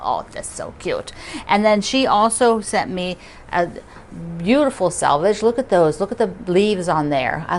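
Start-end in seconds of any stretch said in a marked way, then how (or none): 0.0-1.1 breathy whisper, voice dropped away; whispered aww. Real, not acted. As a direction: whisper it like melting over something cute. Cooing over a cute card.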